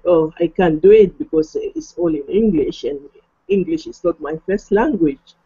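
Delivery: normal talking voice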